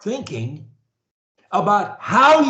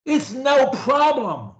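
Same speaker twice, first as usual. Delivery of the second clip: with energy; 6 semitones higher than usual. Shown as a demonstration. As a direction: sell it with energy and conviction. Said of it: "the way I do, with energy".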